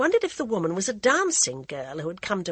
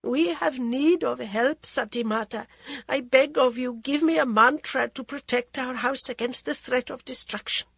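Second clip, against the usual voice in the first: a queen's anxious plea, a little higher; pleading queen; 6 semitones higher than usual. Acted. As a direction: beg the holy woman for a remedy. Maharani begs again.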